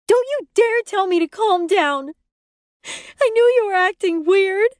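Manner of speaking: high, loud enraged yell; furious shout. A narrator's reading. Wife yelling at husband to not calm her. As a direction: throw it back at him.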